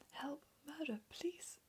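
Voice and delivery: high, frantic whispered cries; a mother panicking, but still whispering; whispered scream. Acted. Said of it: "screamed Missus Gloop".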